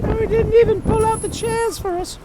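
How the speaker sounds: very high, loud prissy complaint; snooty high voice. Pretend. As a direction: whine it in a prim falsetto.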